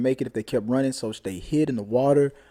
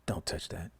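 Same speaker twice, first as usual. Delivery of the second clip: put-on big boy voice; 7 semitones lower, 15 dB quieter than usual. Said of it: "he tries to say it in his big boy voice".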